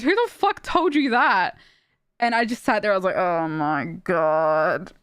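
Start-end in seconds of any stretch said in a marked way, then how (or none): 0.0-1.6 higher, slowed incredulous demand; indignant disbelief. Retold or imitated. Streamer retelling a friend's reaction to the mozzarella claim, incredulous. 3.2-4.9 low, very drawn-out groaned words; weary dismay. Retold or imitated. Streamer retelling sitting there in disbelief, weary dismay. Retold dismay at friend's ignorance.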